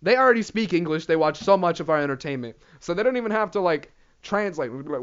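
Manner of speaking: normal talking voice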